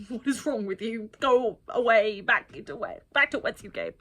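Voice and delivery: a touch of hammed-up shooing; mock outraged dismissal. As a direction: shoo him away in disbelief. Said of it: "I was like".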